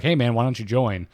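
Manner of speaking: ordinary delivery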